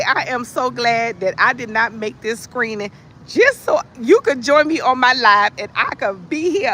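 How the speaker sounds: ordinary talk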